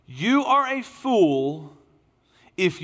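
high, slow, loud pulpit punch; preaching emphasis. Acted. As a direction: land it hard on the congregation. Preacher drives the point home.

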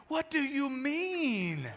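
mock-heartbroken plea. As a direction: stretch it out like a wounded husband. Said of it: "I'm heartbroken".

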